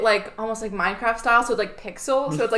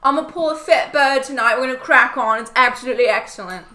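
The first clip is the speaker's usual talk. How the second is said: put-on loud British reality-show accent, pitched up; Love Island lingo; 4 semitones higher, 5 dB louder than usual. Podcaster imitating Love Island slang, exaggerated British accent. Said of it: "The lingo is the best part".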